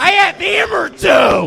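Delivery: high, loud shrieked complaint; high yelled cartoon voice; squeaky character shout. Repeated character bit.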